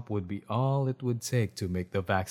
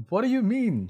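surprised; 12 semitones higher, 5 dB louder than usual. Acted. Heroine shocked by doctor.

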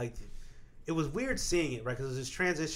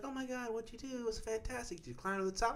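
a hint of an eager grandma, higher and softer; lightly voiced grandma; 7 semitones higher, 9 dB quieter than usual. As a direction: mimic grandma gushing but lightly.

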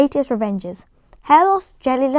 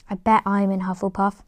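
usual speaking voice

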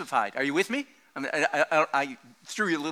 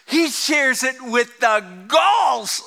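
shouted punchline; 7 semitones higher, 4 dB louder, much slower than usual. Real, not acted. Punchline of sermon joke.

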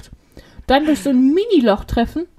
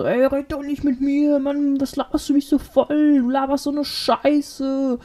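higher, drawn-out mock complaint; retold angry rant; 4 semitones higher, slower than usual. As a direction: imitate a street quarrel, dragging the words. Retold street quarrel between drunks.

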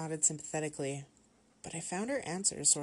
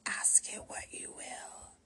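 slow, soft, rasping trance whisper; raspy whisper; 6 dB quieter, slower than usual. A narrator's reading. Victoria inviting questions to the tarot deck, raspy whisper. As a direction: whisper it hoarsely like a possessed medium. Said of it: "she rasped".

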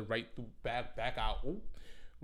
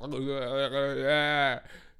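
mock panicked noise; 3 semitones higher, 6 dB louder than usual. Telling how she saw him on the train, mock panicked noise. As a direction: blurt out a wordless, hammed-up panic sound.

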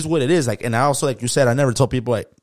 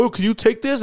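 mock customer voice; 9 semitones higher than usual. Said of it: "people be like".

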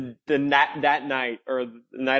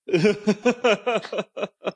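high amused laugh; 7 semitones higher than usual. Real, not acted. Laughs at own complaint.

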